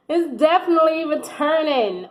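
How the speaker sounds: ordinary talk, nothing marked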